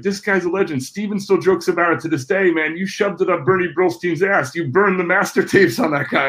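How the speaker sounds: high, gushing hype man; excited fan voice. Retold or imitated. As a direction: rave to your girlfriend about a legend. Spielberg's ex-assistant raves to girlfriend.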